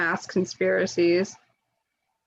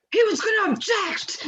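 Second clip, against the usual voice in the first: much higher, indignant exclamation; hammed-up angry discovery; mock outrage; 12 semitones higher than usual. Capitol rioters reading Cruz's itinerary, high mock outrage. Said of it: "they're like".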